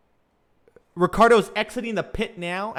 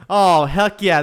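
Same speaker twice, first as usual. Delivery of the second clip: excited; 8 dB louder than usual. Real, not acted. Cheering his favorite driver.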